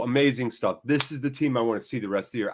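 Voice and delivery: normal talking voice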